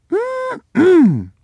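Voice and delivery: high, pleased mm-mm hum; savoring hum. Acted. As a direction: hum with delight at the smell. Savoring a food smell.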